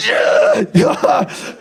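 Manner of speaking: high, loud sarcastic yell; furious yelled yeah. Retold or imitated. Angry punter storming back to yell at the comedian. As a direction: spit it back at him. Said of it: "came back again and said".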